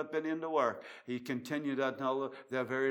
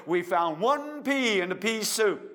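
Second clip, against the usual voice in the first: loud high retold cry; prisoner's joyful shout, retold; mock prisoner shout; 8 semitones higher, 8 dB louder than usual. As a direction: call it out like a hungry prisoner. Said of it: "you hear the prisoner shouting out".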